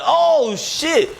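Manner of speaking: squeaky high-pitched gasp of surprise; mock surprise. Fan surprised to see [name] at door, high startled gasp. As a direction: act starstruck and caught off guard.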